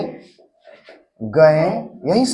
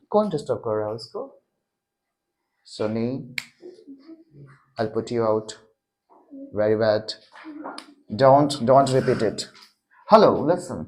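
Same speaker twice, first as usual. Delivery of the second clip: quiet stern scolding; 7 semitones lower, 8 dB quieter, slower than usual. Real, not acted. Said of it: "sir, disturbing".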